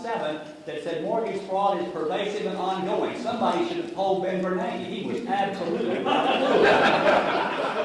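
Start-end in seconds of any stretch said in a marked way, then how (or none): 4.8-7.8 slow, louder, laughing through the words; mock-serious denial breaking into laughter; sarcastic laugh. Real, not acted.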